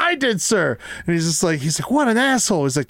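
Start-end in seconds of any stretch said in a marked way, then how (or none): 0.0-0.8 dim gunner. Retold or imitated. Acting out cross-eyed gunner. 1.9-2.6 hammed-up commander exasperation; exasperated officer. Acting out officer.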